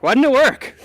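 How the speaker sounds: high, loud, baffled cry; shocked that it broke; dismayed surprise. Real, not acted. Showpiece fails in front of guests.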